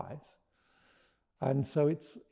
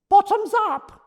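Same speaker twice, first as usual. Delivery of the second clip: high, loud cheery call; cheerful dragon cry; 13 dB louder than usual. Acted. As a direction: shout it like a toast. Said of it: "said Geraldine Green".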